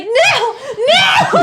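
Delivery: high, loud comic wail of refusal; hammed-up cry of protest; mock protesting shout. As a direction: yell it as if being hauled away. Acts out being dragged away screaming.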